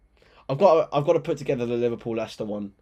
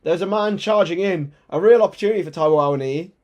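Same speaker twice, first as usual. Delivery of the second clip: higher and louder, still buzzing; excited commentary; 6 semitones higher, 9 dB louder than usual. Real, not acted. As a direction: keep the energy of the chance going.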